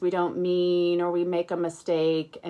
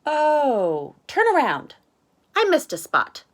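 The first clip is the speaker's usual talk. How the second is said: very high, loud chirpy voice; Max chirpy; 10 semitones higher, 8 dB louder than usual. Acted. Max tells Arthur to turn around, very high chirpy voice. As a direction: chirp it breezily.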